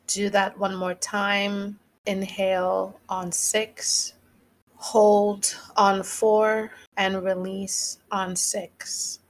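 slow, measured meditation guide; guided breathing. Real, not acted. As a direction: lead listeners calmly through a breath, slowly. Leads a second breath.

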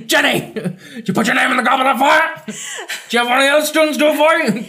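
high mock-yelled interrogation; hammed-up accusing questions; same frantic energy. Dumbledore grilling another random student, high mock yell. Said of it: "He used the same energy too".

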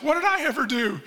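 a reluctant man whining at God; whining Moses. Acted. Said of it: "Moses, quit whining".

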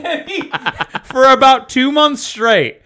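ordinary delivery

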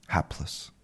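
Hapless said as a soft, breathy, voiceless whisper; a hushed echo of Hapless for the word of the day; soft breathy repeat. Shown as a demonstration. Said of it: "that is today's word of the day".

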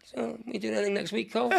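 high, soft and sheepish; embarrassed. Acted. Embarrassed driver to his angry passenger, high, sheepish small talk. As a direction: make awkward small talk, softly. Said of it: "he's embarrassed".

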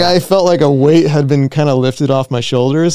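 ordinary talk

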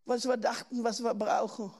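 ordinary talk

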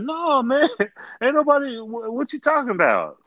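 high, mock-innocent protest; innocent denial. Denying again to the cyclist.

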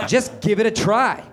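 high-pitched cartoon villain voice; Krang. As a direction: goad them as a cartoon villain. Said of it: "sounding eerily like Krang".

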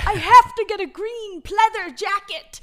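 put-on high, loud, gushing teen shopper; excited teen girl. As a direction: squeal it like a mall-crazy kid. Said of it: "I was like".

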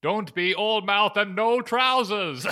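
high, loud, slow wizardly rebuke; Gandalf. Acted. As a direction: bellow it theatrically, drawing out every word. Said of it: "says Gandalf".

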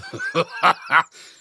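loud, high belly laugh; chuckle. Acted. Grandpa chuckles at his own prank, loud. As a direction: keep laughing at your own joke. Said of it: "lachte nog even om zijn grap".

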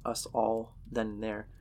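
usual speaking voice

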